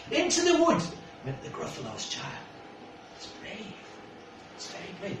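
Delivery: lightly lilting storybook read; gentle read-aloud for a little one; reading to a child. Acted. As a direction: tell it like a bedtime picture book. Reads picture book aloud.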